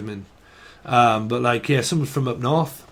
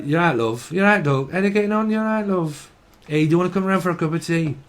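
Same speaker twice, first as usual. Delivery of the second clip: sing-song imitated Yorkshire greeting; broad Yorkshire, lifting in pitch; Yorkshire accent; 4 semitones higher than usual. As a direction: chat like a friendly Yorkshire neighbour. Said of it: "When you go up Yorkshire, they talk".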